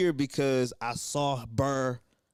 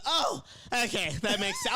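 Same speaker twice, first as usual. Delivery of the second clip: enlightened reply; 8 semitones higher than usual. Retold or imitated. Lady's relieved reply, retold.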